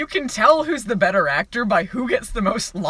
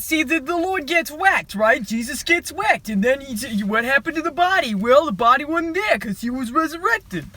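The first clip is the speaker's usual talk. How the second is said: a hint of Italian-American wiseguy, somewhat higher; Italian cliche voice; 5 semitones higher than usual. Pastor telling Kevin Sorbo about the Lord, light mock Italian. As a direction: explain the gospel like a lightly voiced mobster. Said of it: "He says all of the Italian cliches".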